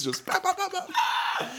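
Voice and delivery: high laughing bah bah; laughing mock shots. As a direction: mimic shots while laughing.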